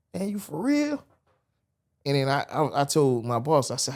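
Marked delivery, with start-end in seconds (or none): 0.1-1.0 much higher, retold in disbelief; stunned disbelief. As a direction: imitate his own amazed question. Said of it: "I'm like".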